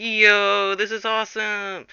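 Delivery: high, drawn-out fan cheer; mock awed excitement. Travellers catch a glimpse of Frodo, mock thrilled. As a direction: stretch it out like a thrilled onlooker. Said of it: "They're like".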